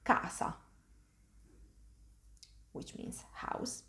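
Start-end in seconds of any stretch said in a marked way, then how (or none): 0.0-0.5 casa as "KAH-sa", hard k; Italian word demo. Shown as a demonstration. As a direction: say casa with a long open first A. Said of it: "An example would be in the word".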